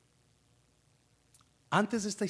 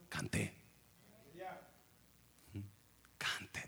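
soft urging; 4 semitones lower, 13 dB quieter than usual. Acted. Quiet repeat.

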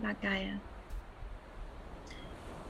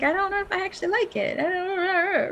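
doubtful whine; 8 semitones higher, faster than usual. Retold or imitated. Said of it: "And you're like".

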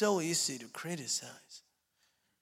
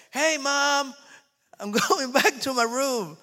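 high, loud call up to mom, laughing partway; mock-innocent son calling out; 8 semitones higher, 6 dB louder, slower than usual. Grown son in the basement calls to his mom, high and loud.

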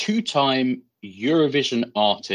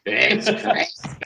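mock shout; 6 semitones higher, slower than usual. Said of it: "musical ones that have [name] shouting".

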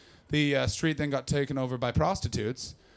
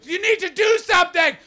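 high, loud hysterical yelling; hysterical shouting; 11 dB louder than usual. Retold or imitated. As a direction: scream at the cops in a frenzy. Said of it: "I just shot at a guy".

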